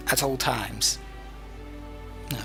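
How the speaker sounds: ordinary delivery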